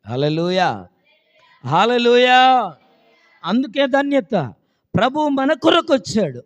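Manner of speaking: high, loud sermon proclamation; loud revival-style cry, pitched high; full-force preaching. Real, not acted. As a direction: preach it out to rouse the congregation.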